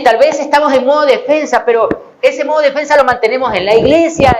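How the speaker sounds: ordinary delivery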